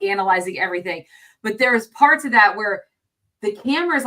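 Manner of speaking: normal talking voice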